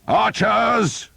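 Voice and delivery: high loud call; shout. Acted. Earl calling archers in battle, high loud shout. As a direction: summon the archers across the field. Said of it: "the Earl shouted".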